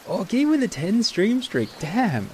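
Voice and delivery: very high, loud, stretched-out exclamation; impressed cheer. Real, not acted. Streamer cheering a viewer's stream streak, excited and impressed.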